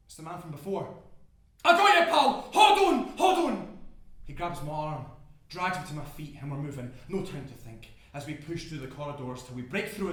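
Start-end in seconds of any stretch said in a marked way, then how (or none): 1.6-3.8 high, very loud urgent call; rescuer's shout. Acted. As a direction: holler to someone fallen in the smoke. Rescuer calls out through the fire.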